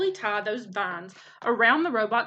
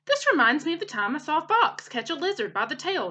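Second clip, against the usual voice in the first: higher, louder chatty squirrel voice; chattering squirrel; 6 semitones higher, 3 dB louder than usual. Acted. Chit Chat chattering from a branch, high and excited.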